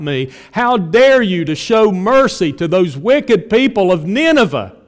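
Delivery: loud, ringing outrage at God; indignant accusation. Acted. Preacher voicing angry complaints to God, indignant and loud.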